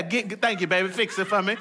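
usual speaking voice

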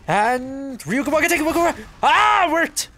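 very high, loud hype; excited. Real, not acted. Gamer screams his teammate's ultimate on, hyped. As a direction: cheer the ult on as it lands.

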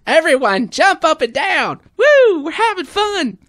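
very high, loud fitness cheer; high, loud workout-instructor whoops; Richard Simmons impression. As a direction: whoop like a hyped aerobics coach. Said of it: "Richard Simmons".

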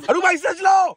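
hammed-up fear as she starts driving; acted panic shout. Passenger hams up panic yelling at the new driver. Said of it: "वाह, क्या acting कर रहा है".

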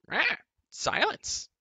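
loud, very high raspy caw; croaking crow. A narrator's reading. Kaw the crow scolding them, loud high croaking caw. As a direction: squawk it like a crow. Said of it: "Kaw croaked".

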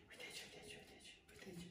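whispered "uite aici", barely audible; hushed coaxing. Real, not acted. Trainer coaxing the nervous dog toward the pad, whispering.

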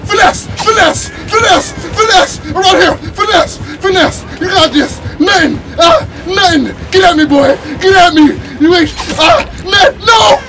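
high loud taunting yells; hyped shouting. Real, not acted. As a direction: taunt the killer at full volume. Taunting killer during escape.

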